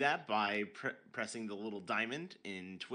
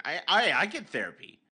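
loud outburst; 8 semitones higher, 12 dB louder than usual. Acted. Joking about depression.

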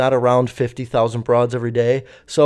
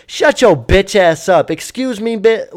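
a slow, louder snap to shut her up; sudden angry outburst; 5 semitones higher, 4 dB louder than usual. Retold or imitated. Passenger lashing out at the stewardess, slow loud outburst. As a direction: lash out, slow and loud, out of nowhere. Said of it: "just open, like".

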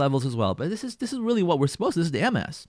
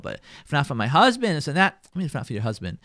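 ordinary delivery

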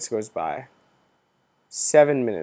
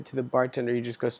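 normal talking voice

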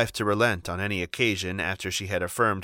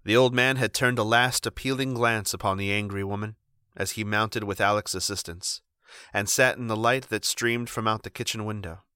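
plain reading voice

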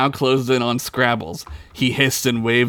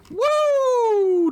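high excited whoop; cheering. Acted. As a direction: let out a big cheer.

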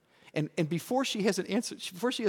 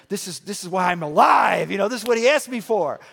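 loud, higher burst of thrilled self-talk; excited inner thought; 4 semitones higher, 10 dB louder than usual. Retold or imitated. Pastor recalling his eager thought at the deathbed, loud. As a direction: blurt out the thrill of the moment.

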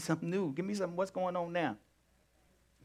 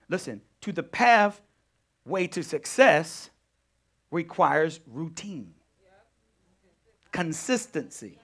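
ordinary talk

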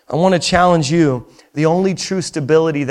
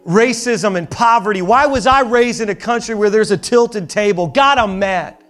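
raised, higher pleading rant at God; angry prayer; 6 semitones higher than usual. Acted. Grieving believer yelling at God, raised angry plea. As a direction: complain to God, building to a raised voice. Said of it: "where you just say".

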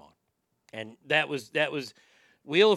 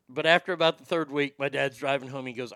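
normal talking voice

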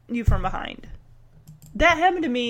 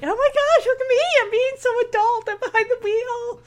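much higher, giddy mock voice; imitated thrill; 11 semitones higher than usual. New driver feeling adult behind the wheel, high giddy imitation. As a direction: marvel at yourself in a high, excited pitch. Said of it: "that thrill".

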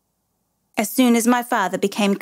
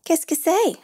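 lightly voiced innocent boy, higher; naive boy; 9 semitones higher than usual. A narrator's reading. Boy feigning ignorance to his father, light naive child voice. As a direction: play it wide-eyed and innocent. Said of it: "I said naively".

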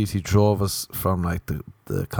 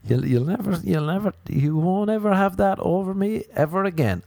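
higher, smug taunting; 10 semitones higher than usual. Pretend. Taunting Sean, his old mate, higher and smug. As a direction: crow over him, higher.

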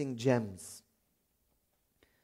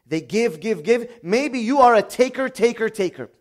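louder and higher with each repeat; building emphatic repetition; 7 semitones higher, 8 dB louder than usual. Real, not acted. Preacher to congregation, building loud repetition. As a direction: hammer the words home. Preacher contrasting givers and takers.

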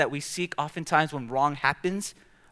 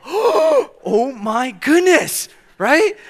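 very slow, much higher and louder drawn-out dismay; shocked exclamation; 11 semitones higher, 10 dB louder, much slower than usual. Retold or imitated. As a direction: stretch it out in hammed-up alarm. Shocked at the cut-off.

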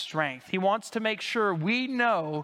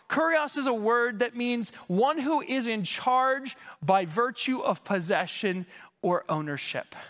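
ordinary delivery

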